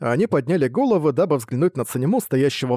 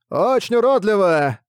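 loud jeer; 7 semitones higher, 3 dB louder than usual. Acted. Deaf man insults statue.

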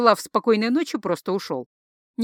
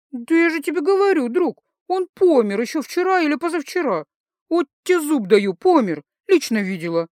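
put-on higher, louder tipsy girl voice; drunk teasing; 6 semitones higher, 4 dB louder than usual. Drunk Lisi teases Jake, high and loud.

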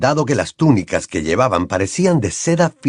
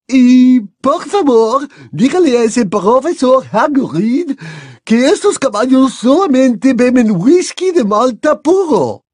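higher, slow, stately giantess; a lofty headmistress, unhurried; Madame Maxime voiced; 13 semitones higher, 5 dB louder, slower than usual. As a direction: speak grandly, slow and raised.

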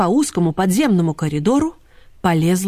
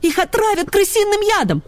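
high, frantic warning; high-pitched panicky plea; urgent alarm; 12 semitones higher than usual. A narrator's reading. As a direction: warn desperately in a high little voice. Little dog urgently pleads for help.

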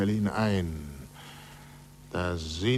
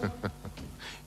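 breathy laugh; 3 semitones lower, 11 dB quieter than usual. Acted. Amused at curator's anger.

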